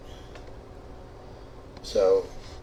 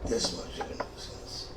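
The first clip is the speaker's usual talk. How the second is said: whispered aside; 8 dB quieter than usual. Real, not acted. Confiding aside.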